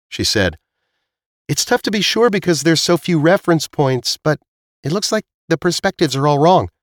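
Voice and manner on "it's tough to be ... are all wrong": high-pitched character voice, puzzled; puzzled Zila. Acted. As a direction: reason it out in a clearly raised voice.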